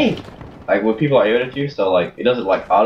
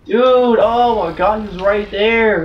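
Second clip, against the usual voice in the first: panicking gamer; 7 semitones higher than usual. Real, not acted. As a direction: yell out in sudden frustration. Teammate walks into his shot.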